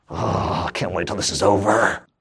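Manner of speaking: low, weary mock grumble of a hardened churchgoer; bored churchgoer. A hardened churchgoer grumbling about the sermon, low and weary. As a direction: sigh it out like you're stuck in the pew.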